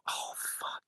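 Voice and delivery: whispered swear to himself; barely voiced, under the breath; private dread. Voice actor realising he must repeat the voice, whispered dread. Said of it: "I remember thinking to myself".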